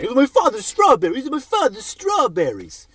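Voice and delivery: high, whiny repeated complaint; mock uptight neighbor. Comic mocking the neighbor's son guarding strawberries, high and whiny. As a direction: whine it like a petty neighbor. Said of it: "this guy's a fucking asshole to me".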